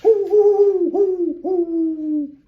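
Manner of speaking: loud, high hooting owl echo; owl call answering. Acted. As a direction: hoot back like a distant owl. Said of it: "an echo came threading its way".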